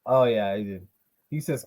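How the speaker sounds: ordinary talk, nothing marked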